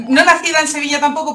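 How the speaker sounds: giggling while correcting the host; laughing. Real, not acted. Guest laughs while correcting where she was born, laughing. Laughing at her own origin story.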